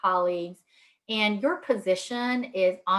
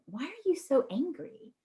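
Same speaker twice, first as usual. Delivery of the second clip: higher-pitched, softer mock challenge; acted-out assumption, pitched up in complaint; mild accusing question; 7 semitones higher, 5 dB quieter than usual. Relative challenging grandma over her tone, mildly accusing. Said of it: "so sometimes we assume".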